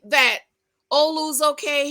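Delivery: normal talking voice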